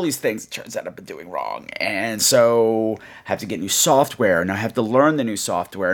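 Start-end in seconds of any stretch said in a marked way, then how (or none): none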